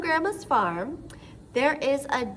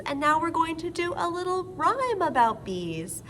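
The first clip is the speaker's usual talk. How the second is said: ordinary delivery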